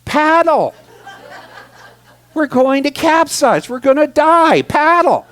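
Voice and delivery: frantic shouted orders in the rapids; retold screaming. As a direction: scream at her to paddle before the boat tips. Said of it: "and I'm screaming".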